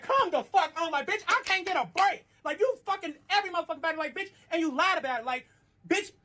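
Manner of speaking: high-pitched, exasperated retold complaint; exasperated outburst. Confronting boyfriend about cheating and lying, exasperated.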